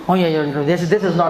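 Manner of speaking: imitating the VC's Bengali English; lightly voiced Bengali professor accent. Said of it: "बोलता English खतरनाक".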